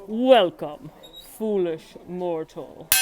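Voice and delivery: slow, spooky welcome; ghost host voice. Shown as a demonstration. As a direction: drag out foolish mortals like a ghost host. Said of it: "imitate the ghost hostess".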